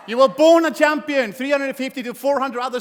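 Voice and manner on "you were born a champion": louder and higher, punch born; motivational shout. Real, not acted. Speaker telling audience they were born winners, proclaiming. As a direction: proclaim it like a rallying cry.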